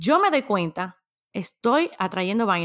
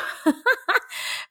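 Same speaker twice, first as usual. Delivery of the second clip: rising, amused laugh; embarrassed laugh; 11 semitones higher than usual. Real, not acted. Vlogger laughing while admitting her own past pattern, embarrassed laugh.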